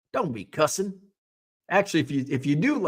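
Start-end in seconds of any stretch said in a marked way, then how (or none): 0.1-0.9 lightly mocked Southern scolding; Southern accent. Showing cuss sounds Southern, light mock Southern accent. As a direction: put on a slight Southern twang. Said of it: "if you say it like that".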